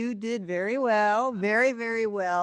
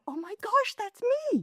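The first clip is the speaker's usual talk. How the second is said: surprised realization; 13 semitones higher, 3 dB quieter than usual. Retold or imitated. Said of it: "And I thought".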